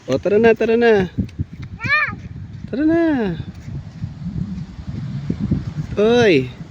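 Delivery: loud call to come along. Real, not acted. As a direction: call them over loudly. Calling kids to come.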